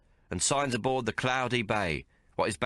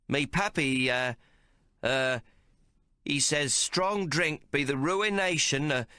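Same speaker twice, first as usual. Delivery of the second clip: country clod; 5 semitones higher, slower than usual. A narrator's reading. Tomo fools the card players, as a bumpkin. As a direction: play a slow-witted country clod. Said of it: "starts up like Timmy Dankmarsh".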